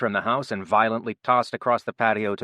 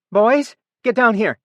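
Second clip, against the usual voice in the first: much higher, loud desperate call; crying out; 13 semitones higher, 11 dB louder than usual. A narrator's reading. Amy calling her sons downstairs, loud and frantic. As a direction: call the boys down in panic. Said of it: "she cried out".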